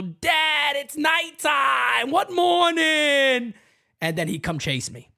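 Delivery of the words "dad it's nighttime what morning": high, drawn-out mock shout; protesting kid. Host as his younger self teasing dad, shouted protest. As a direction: stretch the words out in exaggerated disbelief. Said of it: "And I would go".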